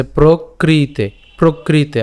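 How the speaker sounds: প্রকৃতে drawn out very slowly, pra-kri-te; slow deliberate প্রকৃতে; slow careful pronunciation. Shown as a demonstration. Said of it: "উচ্চারণটা কেমন হচ্ছে".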